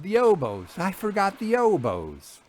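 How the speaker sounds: high, loud dismayed outburst; telling Vera she forgot the oboes, crying out in alarm. Acted. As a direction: cry out at your own foolishness. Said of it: "I cried".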